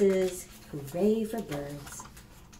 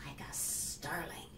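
whisper; 5 semitones lower, 16 dB quieter than usual. Acted. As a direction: breathe it out like a gliding bird. Reading bird book to kids.

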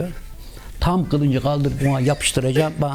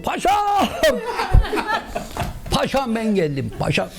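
high calling shout, fading as it goes; calling out to the pasha; 11 semitones higher than usual. Retold or imitated. Ali shouting to the pasha through the crowd, high calling shout. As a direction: start with a much higher cry of "Paşam!", then ease off. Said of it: "bağırdım diyor".

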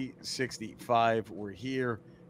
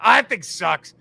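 scornful doctor; 4 semitones higher, 7 dB louder than usual. Retold or imitated. Doctor scorning the drugstore wrap.

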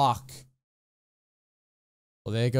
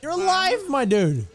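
thrilled and high-pitched; overjoyed cheering; excited; 11 semitones higher, 3 dB louder than usual. Real, not acted. Hero survives, overjoyed.

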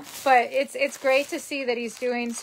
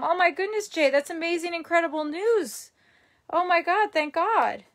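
higher, louder happy gush; real delight; 6 semitones higher, 5 dB louder than usual. Real, not acted. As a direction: gush with relief at the good news. Hears viewer's mother is home.

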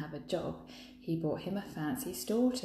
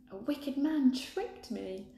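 higher, slow plaintive call; princess voice; 8 semitones higher, slower than usual. A narrator's reading. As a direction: plead down from the window. Princess calls from window.

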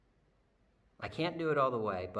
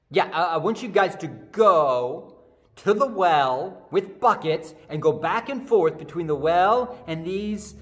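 Jesus insisting to baffled servants, louder; emphatic instruction; 6 semitones higher, 10 dB louder than usual. Acted. Jesus restating the plan emphatically.

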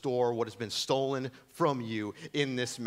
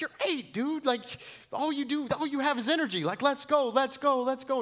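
pushy coach. Retold or imitated. Dad hammily pushing his eight-year-old to run faster, hyped. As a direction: drive her on, over-the-top coach. Father urging daughter while running.

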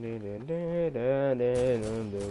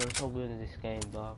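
normal talking voice

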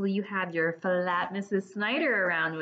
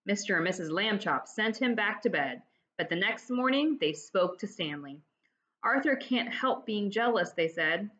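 lively read-aloud storytelling; expressive children's book reading; story narration. A narrator's reading. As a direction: tell it like reading to kids. Reads the story to the class.

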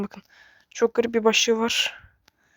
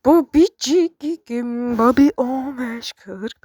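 very slow, drawn-out strange voice; odd mock voice; 2 semitones higher, 4 dB louder, much slower than usual. Gamer reading a creepy message in a drawn-out voice. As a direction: stretch the words like a weird message.

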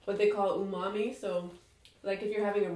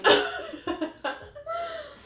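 high laugh. Real, not acted. Reacting to sour candy.

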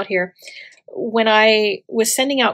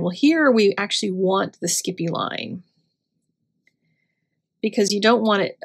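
normal talking voice

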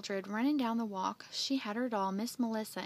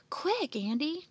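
urgent, higher and louder; crying out; 6 semitones higher, 5 dB louder, slower than usual. Acted. Jeff hurrying Andy as the truck comes, crying out. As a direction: call out with sudden urgency. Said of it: "Jeff cried".

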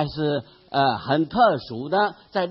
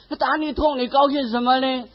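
mother's voice pitched higher, mildly baffled; puzzled mother; 7 semitones higher, faster than usual. Retold or imitated. Mother questions her son, mildly baffled and higher. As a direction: ask it like a puzzled old mother. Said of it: "他的母亲觉得特别奇怪".

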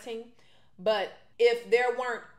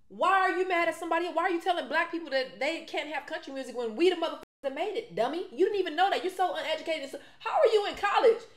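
mocking outrage; 7 semitones higher than usual. Real, not acted. Mocking Asa as uneducated, laughing scorn. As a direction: scoff at her with rising incredulity.

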